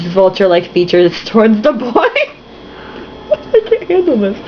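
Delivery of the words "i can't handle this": high, breathless, laughing giving up; can't get through it for laughing; helpless laughter. Real, not acted. Reader giving up on the novel, laughing helplessly.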